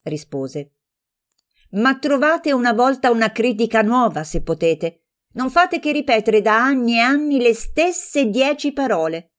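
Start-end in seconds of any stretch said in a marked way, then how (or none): 1.7-9.3 higher and louder, indignant and cutting; stung retort. Acted. Offended friend hitting back at a tired critique, indignant. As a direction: bristle at the old criticism. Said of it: "si risentiva subito e sempre".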